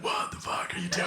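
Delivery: hushed, voiceless demand; aghast whisper. Acted. Guy in the suit confronts drunk fan, shocked whisper. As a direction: whisper it so the crowd won't hear.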